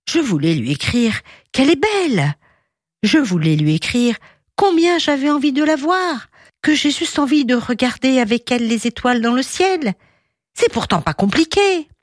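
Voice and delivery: plainte exaspérée, haut perchée; lion frustré qui proteste d'une voix aiguë; rugissement frustré. A narrator's reading. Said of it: "se mit à rugir". (rugissement plaintif, exaspéré)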